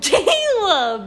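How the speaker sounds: high scandalised cry of a name; shocked laughing. Real, not acted. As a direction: call him out, laughing. Scolding brother, laughing.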